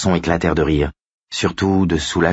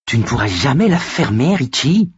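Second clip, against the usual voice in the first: put-on higher, exasperated boy's voice; hissed reproach; 7 semitones higher than usual. A narrator's reading. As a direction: scold a friend in a thin voice. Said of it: "siffla Eddie". (reproche sifflé d'une voix aiguë)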